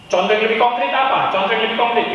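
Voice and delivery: ordinary delivery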